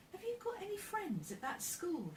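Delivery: lightly voiced inquisitive girl; curious girl. A narrator's reading. Amy asks Joe about friends at school, curious. Girl questions boy in story.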